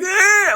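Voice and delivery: high-pitched mock screech; imitated scream. Unknown thing screaming back at her, imitated shriek. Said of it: "it was screaming".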